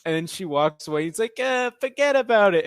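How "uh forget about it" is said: mock New York accent. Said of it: "retold New Yorker".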